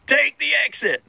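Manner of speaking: high-pitched imitation of a screaming inner voice; mock scream. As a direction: act out an urgent, screamed command. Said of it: "it was screaming".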